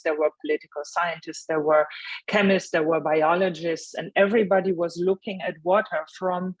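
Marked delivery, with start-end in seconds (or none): none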